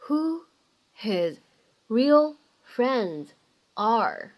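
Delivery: each chunk spaced out slowly, linking shown; slow word by word. Shown as a demonstration. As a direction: read it chunk by chunk with pauses between. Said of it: "当中包含的发音技巧呢". (逐词放慢分段朗读)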